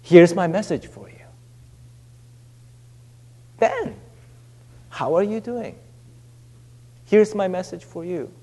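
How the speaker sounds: softly spoken, unhurried message; gentle personal message. Acted. God giving each listener a personal message, soft and intimate. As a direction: speak softly, as if to one person. Acting out God addressing people individually.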